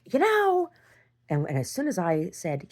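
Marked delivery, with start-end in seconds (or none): 0.0-0.7 high, loud mock yell; shouting out. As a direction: shout to stop him leaving. Retelling a fight, calling out.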